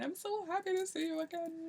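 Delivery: high, soft gushing tone; sappy mock voice. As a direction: gush it like a fairy-tale reunion. Mocking a fairy-tale reunion.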